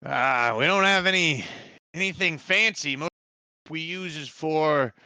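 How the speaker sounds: put-on a wary old villager; village quartermaster.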